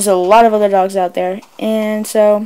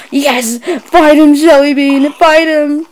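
loud, high urging yell; cheering shout; 6 semitones higher, 7 dB louder, slower than usual. Real, not acted. To Jelly Bean during the fight, a loud cheering yell.

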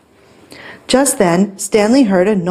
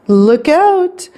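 higher-pitched alarmed cry; urgent warning; 8 semitones higher, 3 dB louder than usual. A narrator's reading. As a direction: call out a sudden warning. Sudden warning in story.

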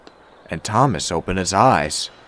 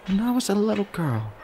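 high, soft woman's voice; 12 semitones higher, 7 dB quieter, faster than usual. A narrator's reading. Woman begins telling Thomas about her childhood, softly. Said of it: "she began softly".

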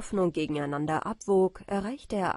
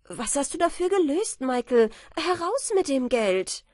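higher, louder demand; demanding wife; 6 semitones higher, 6 dB louder than usual. A narrator's reading. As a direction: insist on the money. Marie fordert das Geld. (Marie fordert lauter das Geld)

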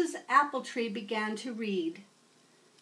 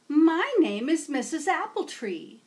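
higher, louder sweet teacher voice; old teacher welcoming a new student; kindly teacher; 5 semitones higher, 6 dB louder than usual. Acted. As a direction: greet a new pupil warmly. Teacher introduces herself.

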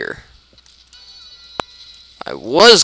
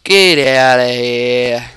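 very slow, stretched-out words; drawn-out dismissal; much slower than usual. Pretend. Player shooing Scout away, drawn-out dismissal. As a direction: drag out every word in a put-on voice.

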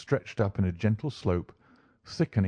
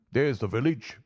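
higher-pitched driver character voice; 4 semitones higher than usual. A narrator's reading. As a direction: point it out as the local driver. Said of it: "said the driver".

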